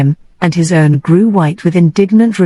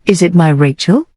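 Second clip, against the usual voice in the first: a touch of disbelief, a touch of joy, rising; half incredulous, half joyful. A narrator's reading. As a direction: ask it half doubting, half glad. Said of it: "half incredulously, half joyfully".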